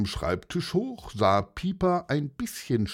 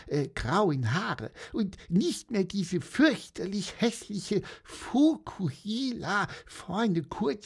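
sleepy peep; 9 semitones higher than usual. Acted. Bird relieved after a dream, high sleepy chirp. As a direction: peep it out half asleep. Pieper's voice.

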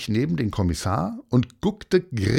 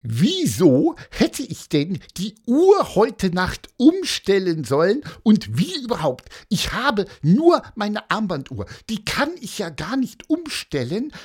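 high, squeaky indignant tirade; worked-up little character grumbling in a high voice; scolding rant; 10 semitones higher than usual. A narrator's reading. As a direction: rail on and on, pitched far up. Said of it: "schimpfte Pieper".

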